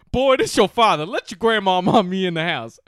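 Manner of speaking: hammed-up dad yelling to be let in; imitating father. Father voiced in retold story.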